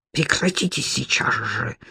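low, soft angry hiss. A narrator's reading. Sudansky stops Sofya splashing water, hissing angrily. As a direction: hiss it through the teeth. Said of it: "зашипел Суданский".

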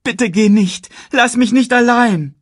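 very high, loud desperate plea; begging. A narrator's reading. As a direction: beg him urgently to stay. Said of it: "bettelte Simba". (verzweifelt und laut flehen)

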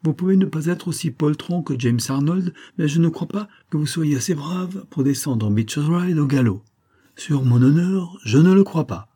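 put-on a major teasing a proud young baronet; taunting.

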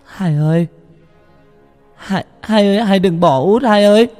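high, loud, desperate wail; wailing cry. A narrator's reading. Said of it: "mà gào khóc". (gào khóc thảm thiết)

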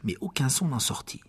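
ordinary delivery

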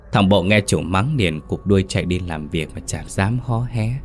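usual speaking voice

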